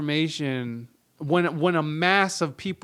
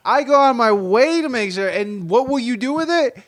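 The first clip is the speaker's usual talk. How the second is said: higher, louder mocking retort; mock exasperation; 7 semitones higher, 6 dB louder than usual. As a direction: throw it back at her, exasperated. Mocking wife in argument.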